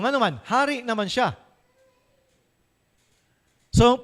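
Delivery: ordinary delivery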